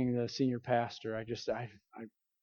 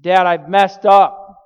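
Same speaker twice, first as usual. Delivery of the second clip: retold anguished cry, loud and drawn out; slow, higher wail to dad, imitating tears; tearful confession; 6 semitones higher, 17 dB louder, slower than usual. Son phoning his dad after messing up, retold tearful outburst. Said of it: "I'm in tears".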